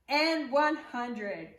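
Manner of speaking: drawn-out finish. Acted. Slowed final count.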